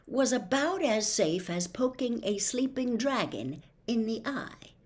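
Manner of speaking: ordinary delivery, nothing marked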